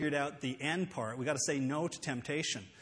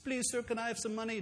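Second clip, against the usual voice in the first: begging beggar; 7 semitones higher than usual. Acted. Beggar asking a visitor for money, pleading. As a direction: plead meekly, pitched up. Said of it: "don't say no like you don't mean it".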